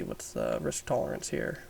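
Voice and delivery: ordinary talk, nothing marked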